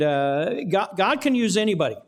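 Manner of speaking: normal talking voice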